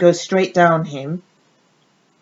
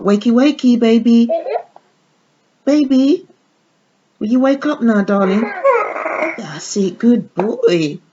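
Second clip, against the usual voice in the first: put-on higher, sing-song coaxing to a baby; mother coaxing her sleeping baby doll, higher coo; baby talk; 5 semitones higher than usual. Waking the doll again.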